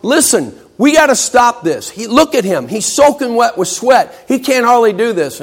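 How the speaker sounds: worked-up students complaining. Acted. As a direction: cheerleaders begging the class to stop. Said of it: "they're complaining".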